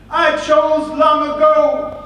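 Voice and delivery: high, slow, full scream. A narrator's reading. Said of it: "she screamed".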